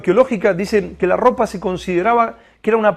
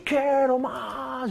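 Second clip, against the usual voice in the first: ruego lloroso; 5 semitones higher, 5 dB quieter, much slower than usual. Retold or imitated. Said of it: "lloraba y decía".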